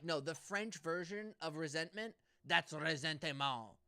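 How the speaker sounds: ressentiment said the French way, slowed down at the end; mock French ressentiment. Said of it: "he specifically was like".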